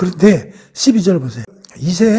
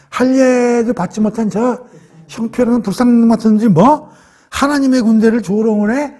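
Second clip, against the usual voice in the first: indignant; 5 semitones higher than usual. Retold or imitated. Young David hearing Goliath mock God's army, indignant. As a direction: act out outraged disbelief at an insult.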